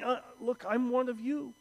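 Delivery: lightly voiced Paul, reasoning as one of them; earnest appeal as Paul. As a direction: win them over, gently insistent. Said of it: "Is Paul saying".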